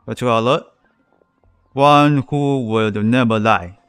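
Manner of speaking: ordinary delivery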